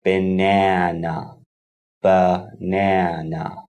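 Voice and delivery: "Banana" slow and low, syllable by syllable; slow syllable demo. Shown as a demonstration. Teacher counting chin drops for learners, "Banana" said slowly in syllables. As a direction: stretch "Banana" out into three drawn-out syllables. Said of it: "pronounce the word slowly".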